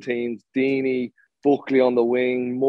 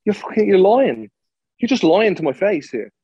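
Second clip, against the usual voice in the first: heated, higher-pitched retold confrontation; indignant accusation; 6 semitones higher, 3 dB louder than usual. Player confronting his manager for lying to his face, heated and indignant. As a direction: raise the pitch and lean into the accusation.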